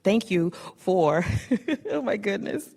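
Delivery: losing composure with a teary laugh; overcome with emotion. Real, not acted. Presenter overwhelmed while giving Shanti her award, teary laugh. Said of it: "I am so emotional".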